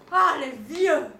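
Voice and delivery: loud, exasperated exclamation; indignant exclamation. Retold or imitated. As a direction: exclaim it out loud, fed up with old folk. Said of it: "je peux pas m'empêcher de m'exclamer". (exclamation indignée, à pleine voix)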